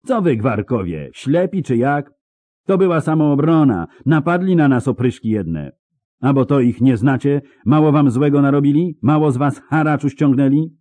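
high, loud indignant tirade; yelling back. A narrator's reading. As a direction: berate the crowd in self-defence. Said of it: "odwrzasnęła, rozglądając się".

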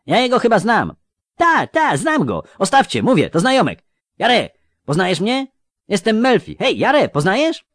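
very high, loud excited youth; young man's voice. A narrator's reading. Young robber recognising an old acquaintance, high and excited. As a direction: call out eagerly, pitched far up. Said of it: "młodym i dziwnie znajomym głosem".

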